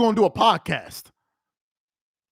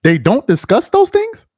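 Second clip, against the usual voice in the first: repeated incredulous echo; 8 dB louder than usual. Real, not acted.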